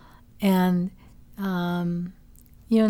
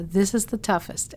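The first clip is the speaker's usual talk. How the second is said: ordinary delivery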